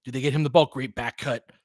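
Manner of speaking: ordinary talk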